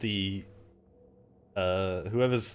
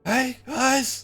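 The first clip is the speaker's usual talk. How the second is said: high excited call; Lulu's voice; 8 dB louder than usual. Acted. GM voices Lulu calling out.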